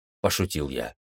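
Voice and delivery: usual speaking voice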